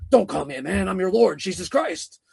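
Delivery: harsh demon posing as Jesus. Retold or imitated. Demon faking Jesus' voice to the witness, harshly. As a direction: put on a harsh voice for the retold demon. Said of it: "in a real harsh tone".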